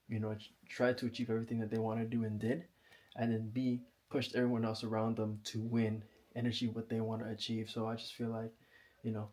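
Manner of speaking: winded, breathy after the gym; out of breath. Real, not acted. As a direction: talk while catching your breath. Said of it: "Sorry, I'm out of breath".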